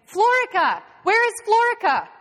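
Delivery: loud, high call for a missing man; urgent shout. A narrator's reading. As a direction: shout out the name searching. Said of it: "George shouts again".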